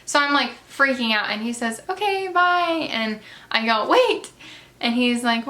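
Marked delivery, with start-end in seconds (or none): 1.9-2.9 a slow, drawn-out goodbye pitched higher; imitating husband. The husband leaving her at the door, a lightly voiced slow goodbye. 3.9-4.3 a high, loud call to stop him. Retold or imitated. Calling her date back as he leaves, a high loud call.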